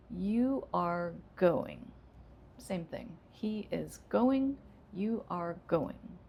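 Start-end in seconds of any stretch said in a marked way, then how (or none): 0.1-1.9 slow, going drops down; falling command. Shown as a demonstration. Teacher modeling a falling command slowly. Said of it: "Let's make that into a command". 3.3-6.2 slow, going drops at the end; falling statement. Shown as a demonstration. Teacher modeling a falling statement slowly. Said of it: "Those both go down".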